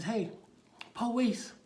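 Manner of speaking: put-on high, loud child calling out; little kid hailing an officer; little boy. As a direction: pipe up like a small kid. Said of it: "there's a little boy there".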